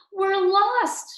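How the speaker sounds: high, anxious admission; scared. A narrator's reading. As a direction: blurt it out in worry. Frightened girl to sister.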